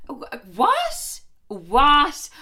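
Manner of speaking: squeaky high shocked what. Real, not acted. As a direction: jump way up in disbelief. Shocked outburst at the quote.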